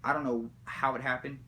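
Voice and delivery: ordinary delivery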